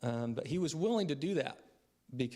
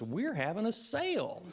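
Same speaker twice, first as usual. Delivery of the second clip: put-on high little-boy voice with an Arkansas accent; a child's high Arkansas twang; 9 semitones higher than usual. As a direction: imitate a kid brother's Arkansas accent on the news. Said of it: "in his Arkansas accent".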